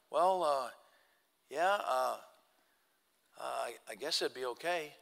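halting, subdued reply; hesitant agreement. Retold or imitated. Man hesitantly answering the preacher about the books, hesitant. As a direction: agree reluctantly with long pauses, softly.

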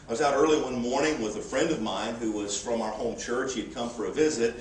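ordinary delivery

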